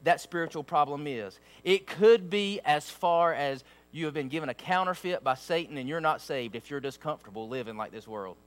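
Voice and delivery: low, hushed pleading to the congregation; soft earnest preaching. Acted. Preacher gently warning his church, softly and low. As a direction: lean in and plead quietly with them. Quiet heartfelt stretch of sermon.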